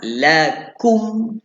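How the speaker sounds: ordinary talk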